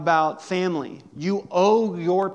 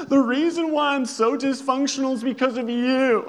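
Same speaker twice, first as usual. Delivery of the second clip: higher and louder, in a put-on whiny accusing voice; a hammed-up wounded accusation thrown at the parents; mock blaming adult child; 8 semitones higher, 5 dB louder than usual. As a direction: play the grown kid blaming mom and dad. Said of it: "call up your parents and be like".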